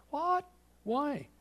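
high-pitched, incredulous questions; mock bewilderment. Preacher wondering why God struck him, mock bewilderment. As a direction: squeak out your baffled disbelief. Mock puzzlement over the leprosy.

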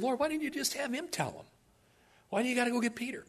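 high, soft, whiny puzzlement; puzzled complaint. Retold or imitated. Preacher asking the Lord about Peter, puzzled complaint. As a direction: question God in a small high voice.

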